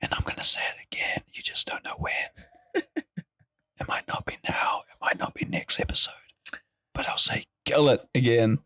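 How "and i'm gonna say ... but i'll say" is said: whispered teasing threat; menacing whisper. Acted. Threatening to say gullet again, whispered. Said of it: "teasing threat whispered".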